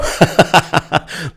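high snorting laugh; a delighted scornful snort; snort of laughter. A narrator's reading. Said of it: "parsknął interpretator". (parsknięcie śmiechem)

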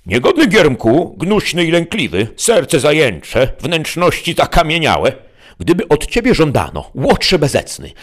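high-pitched declaimed scolding; bold, mannish nymph. A narrator's reading. Nymph boldly berating Sancho for his cowardice, high declamation. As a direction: scold boldly, drawing out the insults. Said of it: "z męską śmiałością i nie nazbyt kobiecym głosem".

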